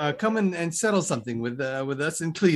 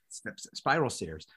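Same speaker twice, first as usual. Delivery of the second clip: ordinary talk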